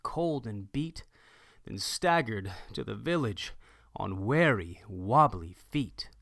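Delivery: ordinary delivery, nothing marked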